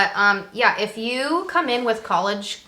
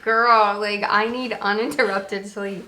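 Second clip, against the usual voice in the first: louder, mock-grumpy plea; grumpy complaint; 4 dB louder, slower than usual. Recruit retelling complaints to those keeping her up, grumpy. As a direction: act out being a grumble puss. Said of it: "I was a grumble puss".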